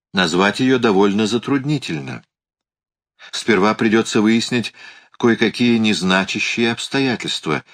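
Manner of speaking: plain reading voice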